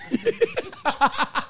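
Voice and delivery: high burst of loud laughter. Real, not acted. Storyteller cracking up at his own punchline, loud laughter.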